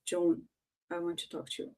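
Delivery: low name, then near-whispered request; hushed voice colour. Shown as a demonstration. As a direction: drop very soft and low, almost whispering. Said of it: "the color you put it".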